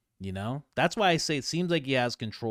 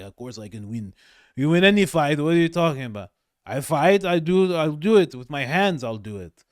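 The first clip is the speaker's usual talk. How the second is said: put-on drunk friend bluster; 4 semitones higher, 7 dB louder than usual. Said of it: "And he's like".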